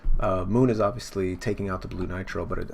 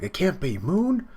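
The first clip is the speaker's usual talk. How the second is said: pitched-up shock, rising sharply at the end; hammed-up stunned realization; acted disbelief; 9 semitones higher, 4 dB louder than usual. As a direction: gasp out mock astonishment, climbing high. Acting out character's disbelief.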